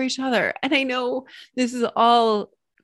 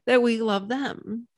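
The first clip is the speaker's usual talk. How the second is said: ordinary talk, nothing marked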